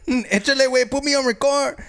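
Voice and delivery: hammed-up South Central Mexican homie, calling out; South Central Mexican accent. Showing off an accent for the co-host, South Central Mexican. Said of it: "That was a South Central Mexican".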